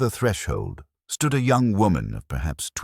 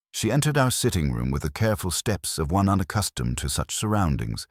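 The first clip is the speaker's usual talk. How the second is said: usual speaking voice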